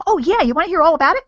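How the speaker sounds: high, loud, gushing burst of eagerness; mock over-excited seller, fast and loud; overly emotional. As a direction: gasp and gush, much too keen to tell. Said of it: "getting too emotional".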